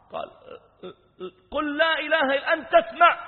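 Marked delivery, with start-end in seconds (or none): 1.5-3.2 very high, loud shout; shouted, desperate insistence; frantic demand. Retold or imitated. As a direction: break off to demand an answer. Demands whether youth hears.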